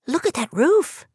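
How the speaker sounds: high little mouse squeak; startled high mouse voice. Acted. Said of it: "Helen squeaked".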